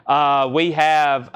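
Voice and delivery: usual speaking voice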